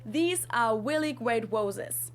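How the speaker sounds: "really great roses" said slowly with W in place of each R; R's as W. Shown as a demonstration. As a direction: say "really" as "weally", "roses" as "woses". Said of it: "try to replace the R's with a W sound".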